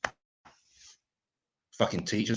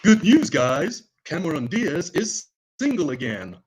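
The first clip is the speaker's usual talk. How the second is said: slow, louder announcer voice; mock headline announcer; 4 semitones higher, 4 dB louder than usual. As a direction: read it like a cheesy news headline. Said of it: "it says".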